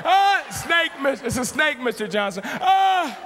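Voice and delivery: high mock-panicked yells; hammed-up hysterical shouting; frantic screaming. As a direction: scream an explanation to the neighbor mid-fight. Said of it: "why I was screaming".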